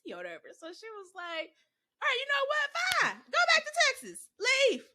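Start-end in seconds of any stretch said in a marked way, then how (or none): 2.0-4.9 high, loud fed-up shout; grandma yelling at the bratty kid to leave; furious grandma. Retold or imitated. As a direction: throw the child out, yelling.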